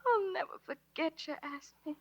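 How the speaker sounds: soft, choked-up words; tearful. Acted. Dallas parting from Ringo, quiet and close to tears. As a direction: hold back the tears.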